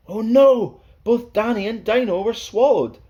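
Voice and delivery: high, loud cry of dismay; mock-horrified oh no; dramatic alarm. A narrator's reading. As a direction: gasp it out to the kids. Dramatic story climax.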